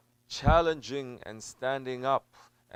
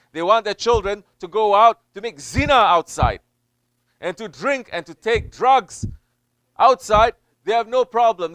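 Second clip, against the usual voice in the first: loud, higher indignant preaching; fiery preaching; 11 semitones higher, 15 dB louder than usual. Acted. Preacher scolding parents before the congregation, loud and indignant. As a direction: thunder at the parents' hypocrisy.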